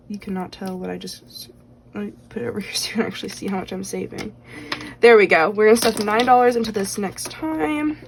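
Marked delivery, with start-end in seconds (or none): 0.1-4.3 whispering. Real, not acted. Quick whispered aside to camera.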